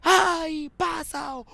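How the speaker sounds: high, drawn-out retold shout. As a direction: imitate a player yelling at a teammate. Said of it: "he started shouting at him".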